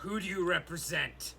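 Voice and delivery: a stern orc addressing the stranger; lightly voiced orc. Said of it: "the orc say".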